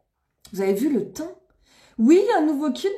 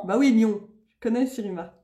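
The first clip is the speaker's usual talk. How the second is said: normal talking voice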